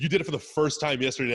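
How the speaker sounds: incredulous dressing-down of a copycat; exasperated call-out. Acted. Coach to coaches copying his exercises, exasperated call-out.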